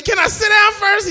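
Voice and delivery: high-pitched, loud retort; high protest. Retold or imitated. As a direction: pipe up loudly in protest. Exasperated grandson retelling his protest.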